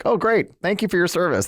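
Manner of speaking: high, breezy, dismissive thanks; mock DeSantis brush-off. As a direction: toss it off like a politician who isn't listening. Mocking DeSantis brushing off veteran.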